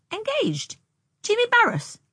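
very high astonished questions. Acted. Anne hearing that Jimmy is engaged, astonished. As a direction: squeak up high in disbelief. Girl hears surprising engagement news.

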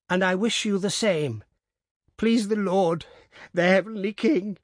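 put-on high old woman, voice starting to break; beginning to cry. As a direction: repeat it as tears rise. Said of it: "she repeated, beginning to cry".

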